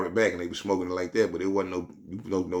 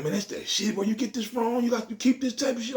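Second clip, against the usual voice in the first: higher, impressed clerk voice; voicing the clerk; 12 semitones higher, 5 dB quieter than usual. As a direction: raise the pitch, sound impressed.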